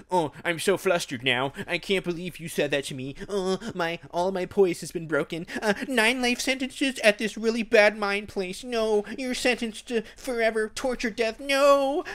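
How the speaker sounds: mock a rattled judge losing all poise; flustered judge. Judge flustered by her comeback, squeaky hammed-up panic. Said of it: "the judge is like".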